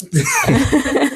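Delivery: high-pitched burst of laughter; a quick, bright laugh. Real, not acted. Éclat de rire. (éclat de rire aigu)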